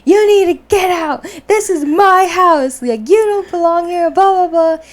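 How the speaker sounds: telling the ghost to leave, shouting high; brave yell at the ghost. Retold or imitated. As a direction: scream her out of the house with all your courage. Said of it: "I find all the courage in my freaking body".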